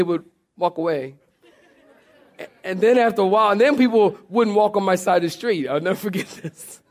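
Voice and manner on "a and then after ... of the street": ordinary delivery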